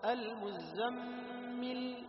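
المزمل drawn out very slowly, لام held; slow recitation of المزمل; long lam demo. Shown as a demonstration. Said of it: "لاحظ".

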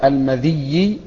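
"المذي" with kasra on dhal and doubled ya; تشديد الياء. Shown as a demonstration. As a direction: say "المذي" as al-ma-DHIYY-i. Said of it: "بكسر الذال وتشديد الياء". (نطق "المذي" بكسر الذال وتشديد الياء)

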